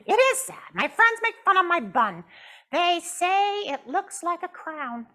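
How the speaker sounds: pouting puppet. Acted. Puppet Jasmine tells the counselor she is mad, pouting high. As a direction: stretch out the sulk.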